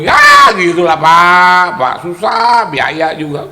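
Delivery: loud whining. Retold or imitated. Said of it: "Bos, waduh, nambah lagi".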